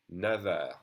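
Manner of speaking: "never" with a clear V in the middle; V liaison. Shown as a demonstration. Teacher repeating the liaison for learners, V sound in "never". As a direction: link French neuf onward with a V, "never". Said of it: "we pronounce that never".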